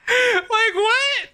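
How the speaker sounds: high-pitched laughter spilling into the words; laughing disbelief. Real, not acted. Streamer mocking the game's soggy pizza, high laughing disbelief. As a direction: crack up while asking what just happened.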